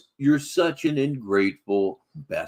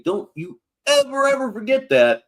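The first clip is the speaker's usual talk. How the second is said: mock menacing shout; 10 semitones higher than usual. Real, not acted. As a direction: bellow the warning. Host ends rant with threat.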